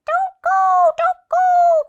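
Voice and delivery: very high, loud squawking warning; crying seagulls. A narrator's reading. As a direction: shriek the warning like frantic gulls. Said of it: "cried the seagulls".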